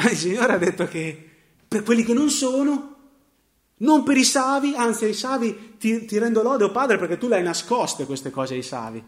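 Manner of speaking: loud, high, ringing proclamation; fervent preaching. Real, not acted. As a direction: preach it out with full force over the hall. Preacher exclaims Jesus' praise to Father.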